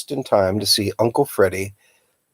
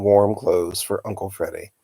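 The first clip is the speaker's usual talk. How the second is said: ordinary delivery, nothing marked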